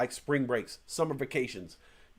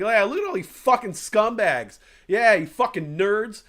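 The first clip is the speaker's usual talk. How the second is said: imitating a guy loudly clowning on cult members; jeering, taunting street heckle, a bit louder; retold mocking tirade at the Scientologists; mock heckling rant; 6 semitones higher, 4 dB louder than usual. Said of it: "and just, like, going off".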